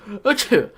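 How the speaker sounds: loud hammed-up sneeze; fake sneeze. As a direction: sneeze as if freezing.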